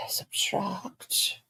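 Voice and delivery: soft whisper doing sums; quiet calculating whisper; whispering to herself. Real, not acted. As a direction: whisper the figures under breath.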